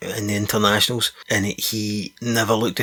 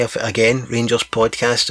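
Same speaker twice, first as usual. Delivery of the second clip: usual speaking voice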